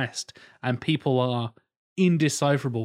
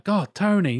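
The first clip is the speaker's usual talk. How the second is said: higher, louder Norfolk local; imitated Norfolk pub-mate accent; strong country accent; 7 semitones higher, 5 dB louder than usual. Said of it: "very strong accented people".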